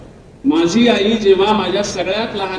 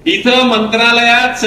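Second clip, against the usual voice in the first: normal talking voice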